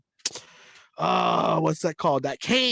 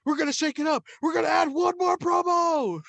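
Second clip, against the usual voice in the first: high, loud wrestling promo yell; mock wrestling promo shout; hyped promo shout; 12 semitones higher, 7 dB louder than usual. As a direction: belt it like a pumped-up wrestler.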